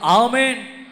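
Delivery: rally the congregation with a shout; loud amen. Real, not acted. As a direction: call out a loud, high amen. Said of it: "గట్టిగా". (గట్టిగా ఆమేన్)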